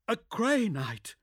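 high, slow, astonished question; can't believe it; surprised. Acted.

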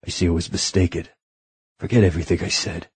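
quick, soft, clipped dismissal; curt dismissal. A narrator's reading. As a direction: brush him off quickly. Desmond leaving in disgust.

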